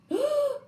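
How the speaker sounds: loud, high gasp; shocked gasp. A narrator's reading. Zookeeper seeing the empty cages, a shocked gasp. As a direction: gasp in horrified disbelief. Zookeeper finds animals gone.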